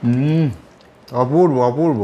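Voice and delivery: muffled murmur between bites; chewing food. Real, not acted. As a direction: mouth full while speaking. Seen 1: ate a bite at -1; mouth closed, jaw working during words.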